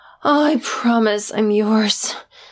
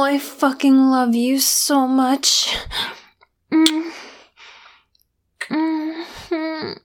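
ordinary delivery

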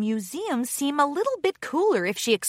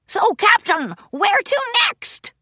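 pirate bird voice; 12 semitones higher, 5 dB louder than usual. Acted. Bird pirate asks captain.

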